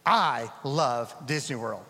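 usual speaking voice

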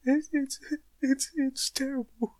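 high-pitched fake sobbing; mock crying. Comic confessing to his comforting mate, badly faked weeping. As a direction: squeal it through pretend tears. Mock therapy, pretends to weep.

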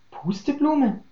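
stunned that she is standing there; startled question. A narrator's reading. Tulpe behind the door hears her old friend's name, startled.

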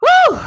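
high, loud celebratory whoop; excited woo. Real, not acted. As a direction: let out a hype cry. Host opens show hyped.

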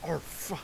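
"earth" repeated with deliberate emphasis; stress on EARTH. Real, not acted. Repeating himself for comic effect, stress on "earth". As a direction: dwell on the word "earth". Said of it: "I put the emphasis on earth".

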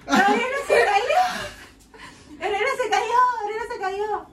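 high, loud panicked yelling of a friend's name; frantic shout. Retold or imitated. Friend shouting that Gabriela fell, frantic yell. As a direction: scream that she fell, half laughing. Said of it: "y grita".

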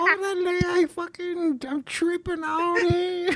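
very high, slow, fake sobbing through laughter; hammed-up falsetto sob; mock crying. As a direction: overplay a breakdown, half laughing. Acting out the Selena ending.

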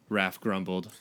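ordinary talk

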